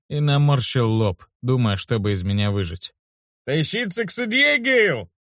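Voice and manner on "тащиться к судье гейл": much higher, displeased guard's voice; guard reluctant to walk to the judge, displeased; grumbling question. A narrator's reading. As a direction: complain about hauling him to the judge. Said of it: "недовольно спросил".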